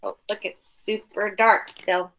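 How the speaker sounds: ordinary delivery, nothing marked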